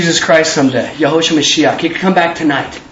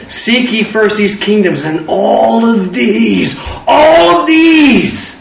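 drawn-out scripture quote; much slower than usual. Acted. Preacher intoning the verse to the congregation, slow and stretched.

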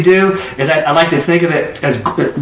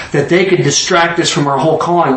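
normal talking voice

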